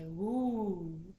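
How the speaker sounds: long, loud storyteller's ooh; awed ooh. A narrator's reading. Animals watching the flames grow, long awed ooh. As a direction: draw out a loud ooh of wonder. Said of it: "all the animals say".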